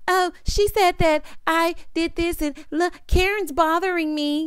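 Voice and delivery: mocking whiny tattler. Mocking cast member tattling to leader.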